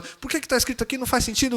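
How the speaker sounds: high-pitched mock exasperation; imitating an indignant demand for answers; retold arguing. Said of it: "daí eu brigava com ela".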